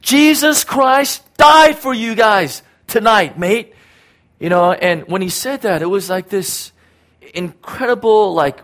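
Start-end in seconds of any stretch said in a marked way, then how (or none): 0.0-3.7 high, loud, slow shout; slow shouted proclamation; retold scream. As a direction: bellow it over the drunken street. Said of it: "And he screams out".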